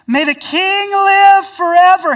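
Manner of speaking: high, loud sincere call; genuine loud blessing. Acted. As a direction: proclaim it with honest conviction.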